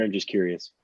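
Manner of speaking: ordinary talk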